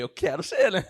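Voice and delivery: 笑着说出的高声回答；边笑边说“我想被录用嘛”；笑着回答; laughing reply. Retold or imitated.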